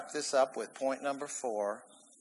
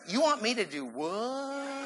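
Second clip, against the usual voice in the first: mock disbelief; 6 semitones higher than usual. Balking at God's crazy answer, mock disbelief. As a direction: question it in exaggerated astonishment.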